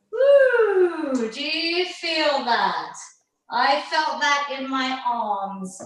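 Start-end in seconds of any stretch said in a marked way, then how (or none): 0.1-1.3 cheer. Real, not acted. Last rep of raises done.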